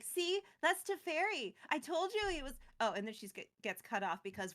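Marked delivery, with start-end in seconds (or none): 0.0-2.5 mildly excited. Retold or imitated. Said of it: "she's like".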